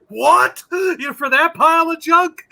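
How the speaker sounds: high-pitched, incredulous scoff. Retold or imitated. Bystander reacting to the seller's price, scoffing. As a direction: scoff in disbelief, voice jumping up. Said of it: "he scoffs".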